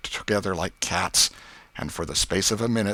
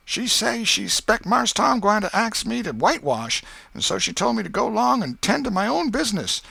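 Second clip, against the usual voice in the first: put-on young Jim, reluctant; 13 semitones higher, 5 dB louder than usual. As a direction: beg off the job nervously. Jim's voice in the story.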